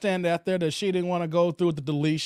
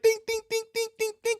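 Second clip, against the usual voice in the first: imitated beeping; faster than usual. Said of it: "he's like".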